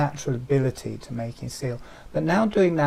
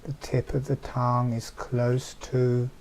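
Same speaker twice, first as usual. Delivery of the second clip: guided meditation. Acted. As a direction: soothe them into letting go. Eyes closed, deep relaxation guidance.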